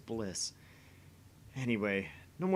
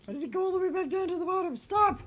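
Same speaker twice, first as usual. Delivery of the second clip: high, loud, fast panicked yelling; frustrated shriek; 7 dB louder, faster than usual. Real, not acted. Player yelling at the scrolling menu, high fast shriek. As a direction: shriek in exasperation, racing.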